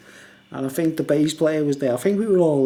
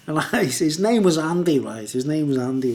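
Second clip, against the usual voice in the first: usual speaking voice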